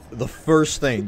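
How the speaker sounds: ordinary talk, nothing marked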